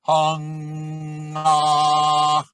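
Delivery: Hung ah with the ah held back in the throat; back-placed ah. Shown as a demonstration. Voice teacher demonstrating to students, Hung ah sung with the ah held back. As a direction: sing Hung ah keeping the ah back at the closure. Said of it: "keep the ah back there".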